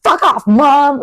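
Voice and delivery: mock-yell it at your mum; mock outburst. Daughter showing what she'd never say to mum, mock angry outburst. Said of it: "I'm not gonna go".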